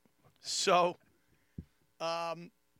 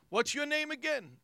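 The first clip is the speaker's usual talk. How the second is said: higher eager question; eager fan; 8 semitones higher than usual. Retold or imitated. Bar fan asking Keegan's name, eager high question. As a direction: lean in curious. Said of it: "starts in again".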